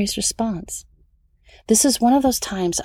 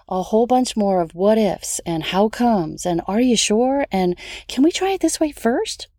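usual speaking voice

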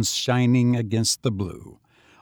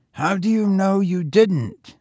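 higher, youthful lad's voice; street boy; 8 semitones higher than usual. A narrator's reading. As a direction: play the lonely young tramp, higher. Boy's voice.

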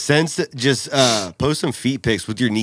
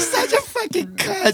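mock squeaky voice. Friends mocking, high squeaky voice.

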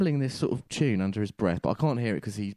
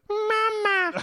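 babies' voice. Retold or imitated. Babies speaking together.

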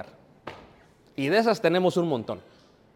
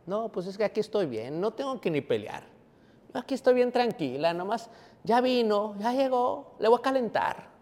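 complacent woman; 3 semitones higher, 6 dB quieter than usual. Acted. Preacher mimics the mother who won't fight, complacent woman. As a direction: lightly play a woman content to stay home.

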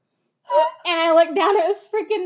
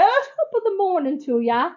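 higher-pitched attempt at an Irish lilt; Irish accent; 5 semitones higher than usual. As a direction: copy a cheery Irish greeting. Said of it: "Can you do an Irish one?".

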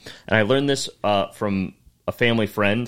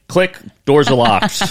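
slow, loud, raised punchline; mock trap reveal; 5 semitones higher, 8 dB louder, slower than usual. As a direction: land the joke slowly and loudly. Joke, mock menacing punchline.

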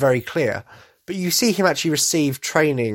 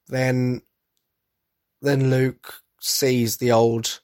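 ordinary talk, nothing marked

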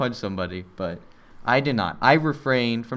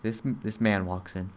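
ordinary talk

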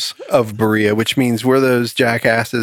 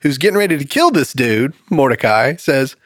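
normal talking voice

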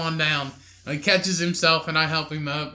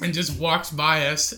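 normal talking voice

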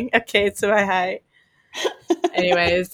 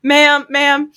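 higher, louder mock cop calling out; officer calling; 7 semitones higher, 7 dB louder than usual. Police officer calling after her in the store, mock louder call. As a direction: bark it like a cop flagging someone down. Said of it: "police officer was like".

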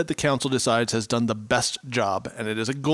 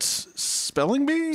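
high, slow questioning rise; 13 semitones higher, much slower than usual. Real, not acted. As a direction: check the name, unsure.

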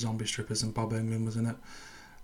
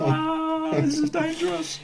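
squeaky falsetto; 6 dB louder, much slower than usual. Pretend. Mock panic falsetto.

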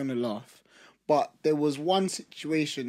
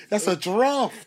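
laughing; 8 semitones higher, 5 dB louder, slower than usual. Real, not acted. Laughing joke.

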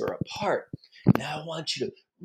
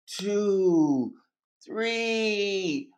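drawn-out, held numbers; slow sustained counting; long count. Shown as a demonstration. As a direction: stretch each number out long. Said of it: "this is a long one".

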